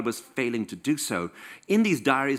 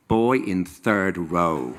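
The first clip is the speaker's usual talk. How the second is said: swooning diary line; 5 semitones lower, 4 dB louder, much slower than usual. Pretend. Mock diary entry, swooning.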